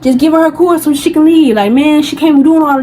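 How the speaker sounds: other guy. Retold or imitated. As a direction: play the guy stepping in, urging. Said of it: "He like".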